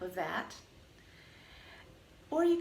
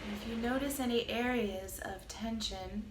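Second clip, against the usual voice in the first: calm, even meditation voice with long pauses; guided meditation; 5 dB quieter than usual. Acted. Teacher guiding listeners through a body scan, calm meditation voice. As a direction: lead a body scan, unhurried, leaving space between phrases.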